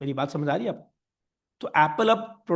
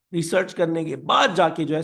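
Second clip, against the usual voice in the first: ordinary talk, nothing marked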